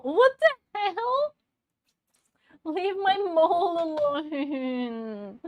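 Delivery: high, loud, slow laughing whine; hammed-up outrage, half laughing; mock outrage. Mock outrage at chat over mole.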